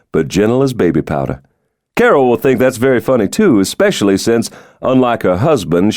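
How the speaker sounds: genial president. Acted. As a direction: play the charming president joking about his security.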